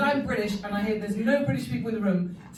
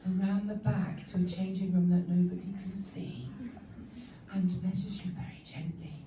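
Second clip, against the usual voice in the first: quiet, discreet telling; a hint of hushed discretion; 3 semitones lower, 7 dB quieter than usual. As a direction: lower the voice like a discreet shop lady. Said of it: "whispers your measurements".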